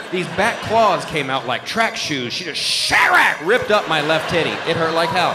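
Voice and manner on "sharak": slow, loud ripping noise; a drawn-out mock tearing sound; imitated claw rip. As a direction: sound out claws tearing skin. Claws ripping through skin.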